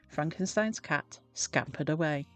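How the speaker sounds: ordinary delivery, nothing marked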